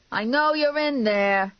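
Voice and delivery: high, drawn-out yell. A narrator's reading. Man demanding entry at her door, yelling. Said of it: "Vinny yelled".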